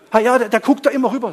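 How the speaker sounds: high, loud, hurried mock grievance; acted agitated complaint. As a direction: play a worked-up complainer. Acting out the worried believer's complaint.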